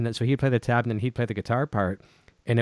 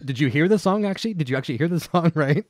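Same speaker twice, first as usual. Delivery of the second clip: higher, laughing disbelief; amused, pitched-up challenge; mock incredulity; 5 semitones higher, 3 dB louder than usual. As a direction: question them incredulously, half laughing. Mocking makers of bad tablature.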